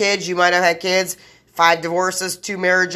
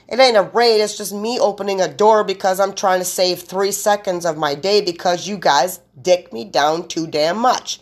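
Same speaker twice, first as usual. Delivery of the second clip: normal talking voice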